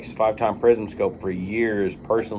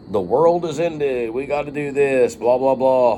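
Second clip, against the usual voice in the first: mocking doomsday voice; 4 semitones higher than usual. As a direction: parody the end-of-the-world videos.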